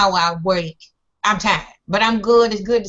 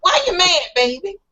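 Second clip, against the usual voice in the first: mock sweet tease; 8 semitones higher than usual. Teasing repeat.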